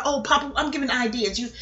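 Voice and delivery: ordinary delivery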